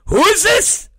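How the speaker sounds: high-pitched indignant question, imitating the janitor; incredulous demand. Night janitor grabs the phone from the manager, indignant. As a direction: voice leaps up in mock disbelief.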